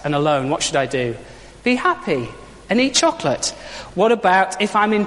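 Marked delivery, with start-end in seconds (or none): 1.6-3.5 high, pat slogan with falling ends; chirpy Easter Bunny answer. Acted. Preacher voicing the Easter Bunny's answer to hard life, chirpy. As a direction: chirp it as a pitched-up, unhelpful refrain.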